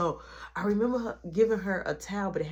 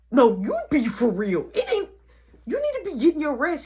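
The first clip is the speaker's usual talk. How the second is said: loud, high sisterly retort; acting out telling off a kid; raised-voice bossy comeback; mock scolding; 8 semitones higher, 8 dB louder than usual. Retelling scolding little brother.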